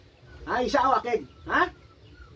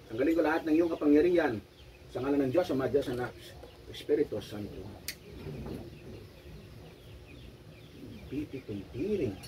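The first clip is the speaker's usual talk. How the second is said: exorcism prayer; 6 semitones lower than usual. Real, not acted. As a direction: murmur the prayer over her. Healer recites exorcism prayer.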